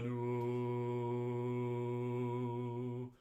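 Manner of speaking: one steady pitch, smooth and carried; monotone projected speech. Shown as a demonstration. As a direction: speak on a single note into the next room. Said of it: "release more air".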